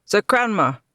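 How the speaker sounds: loud calling across the battle; shouting for comrades. A narrator's reading. Knight searching the battlefield, calling loudly. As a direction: call out to find them. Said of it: "he shouted".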